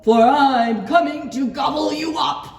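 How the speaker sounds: big loud storybook menace; threatening troll. A narrator's reading. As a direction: shout it like a hungry troll. Troll threatens to eat goat.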